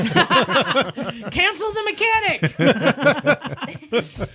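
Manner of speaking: slow words through laughter; laughing quip. Real, not acted. Cohost jokes about cancelling the mechanic, laughing. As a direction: drawl it out while laughing. Joking reply amid laughter.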